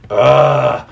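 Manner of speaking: loud, frustrated "ugh"; exasperated groan. Real, not acted. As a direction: groan it out, worked up. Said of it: "I'm getting worked up".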